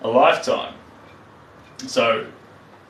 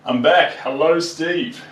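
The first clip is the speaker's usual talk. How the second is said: loud cheerful greeting; 5 semitones higher, 6 dB louder, slower than usual. Real, not acted.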